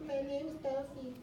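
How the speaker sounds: high squeaky puppet voice with a giggle; silly puppet voice. Shown as a demonstration. Teacher demonstrating a voice style for Dolphy. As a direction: giggle then squeak the name. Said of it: "Is it raspy?".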